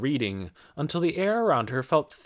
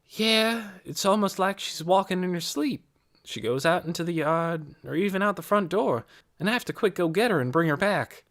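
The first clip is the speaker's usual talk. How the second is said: put-on higher-pitched girl's voice, reporting; Amanda, matter-of-fact girl; 7 semitones higher than usual. A narrator's reading. Girl reporting on an ailing woman, high and matter-of-fact. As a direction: tell it plainly in a high girlish voice.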